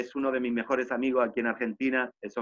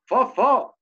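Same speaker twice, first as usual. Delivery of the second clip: high, louder call of his name; a friend hailing him with a high, louder call; 11 semitones higher, 8 dB louder than usual. Retold or imitated. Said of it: "Y me dijo".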